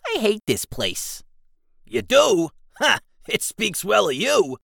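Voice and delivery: high, squeaky goblin grumble. Acted. Goblin Jigsaw answers his partner in a high grumble. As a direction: pitch it way up like a small cranky goblin.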